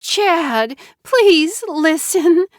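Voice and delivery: high, slow, loud pleading; tearful plea. A narrator's reading. Wife pleading with angry husband, high and slow. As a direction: drag out the plea.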